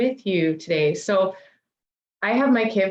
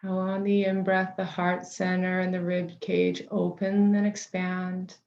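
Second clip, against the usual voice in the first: unhurried meditation guide, long pauses between phrases; guided meditation; 4 dB quieter than usual. Real, not acted. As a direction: settle listeners gently into the body, leaving space for each breath.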